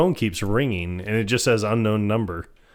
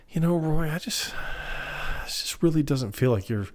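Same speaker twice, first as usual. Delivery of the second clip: hurt complaint; 5 semitones higher, 6 dB quieter than usual. Retold or imitated. Woman hesitates, hurt, in retold scene.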